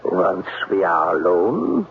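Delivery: put-on menacing German accent. German-accented Klemper hints at what he wants once they're alone. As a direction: let the threat creep into the German accent.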